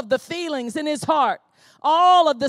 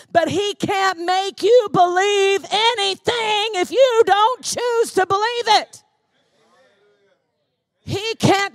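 loud, rising preaching, driving each line home; full-force preaching; 3 semitones higher, 6 dB louder than usual. Acted. Preacher urging the congregation to stand on the word, loud and fervent. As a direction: preach it out with mounting fervour.

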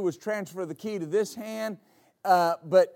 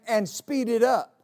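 usual speaking voice

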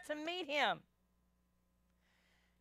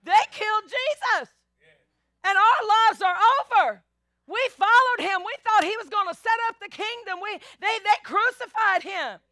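distraught disciples; 6 semitones higher, 5 dB louder than usual. Retold or imitated. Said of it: "Are they".

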